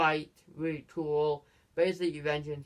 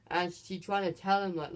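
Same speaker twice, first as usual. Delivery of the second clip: usual speaking voice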